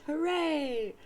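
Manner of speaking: loud, high happy cheer; kid's cheer. Acted. John cheering at Nana's promise, loud and high. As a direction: cheer like a boy promised treats. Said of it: "And John says".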